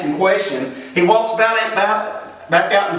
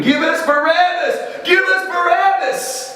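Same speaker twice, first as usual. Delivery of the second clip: imitated crowd scream; 12 semitones higher than usual. Crowd answering Pilate's offer of a prisoner, high mock scream. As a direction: cry it out like a mob, climbing on the repeat. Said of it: "they went screaming".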